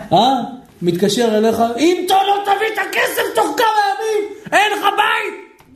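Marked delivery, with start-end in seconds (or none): 1.8-5.6 mock shouting debt collector, high and pushy; shouted threat. A collector phoning a debtor, in a mock shouted threat. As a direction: yell the threat like a caller demanding money. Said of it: "למה הוא צריך לצעוק".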